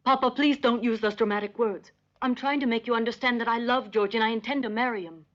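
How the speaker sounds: high, earnest insistence; impassioned pleading. Acted. Daughter tells father she will marry George, earnest pleading. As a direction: plead firmly to be understood.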